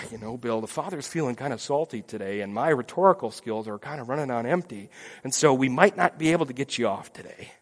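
tired lawyer breaking bad news gently; weary advocate. Jesus warns Bill before trial, low and weary.

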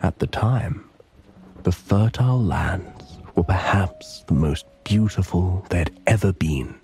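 plain reading voice

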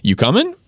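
"coming" lifted high as a question; short casual question, pitch climbing on "coming"; upwards inflection. Shown as a demonstration. Student asking the teacher about questions, with upwards inflection. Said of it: "a little bit of an upwards inflection".

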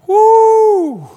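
a very high, loud whoop of praise; joyful whoop. Real, not acted. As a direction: let out a celebratory whoop. Preacher celebrating in sermon.

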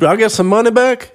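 high mock patient whine, far above his own pitch; quoting a patient who wants money back, high mock voice; mock greedy patient. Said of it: "somebody ever asked you that".

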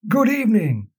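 formal newsreader bulletin; news read. Acted. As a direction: read it like the ten o'clock news.

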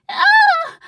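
loud, very high scream; terrified scream. A narrator's reading. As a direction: shriek out in horror. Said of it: "she screamed".

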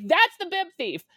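retold shout. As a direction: point him out loud to the officials. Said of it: "And so I shout".

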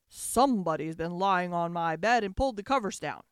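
low, loud, great big growl; growling father bear. A narrator's reading. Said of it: "he growled in his great big voice".

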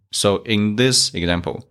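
ordinary talk, nothing marked

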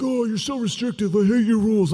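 put-on high, whining child's protest; whiny kid. Child whining at his father, high and petulant. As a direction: whine like a kid defying dad. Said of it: "They're like".

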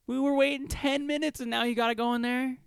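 high-pitched whiny griping; mock complaint. Friend kept waiting ten minutes, whiny mock complaint. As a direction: whine it up high, in disbelief.

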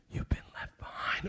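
unvoiced haunting whisper in his head; ominous whisper. Voice in the boy's head warns him, ominous whisper. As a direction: whisper it like a creeping dread.

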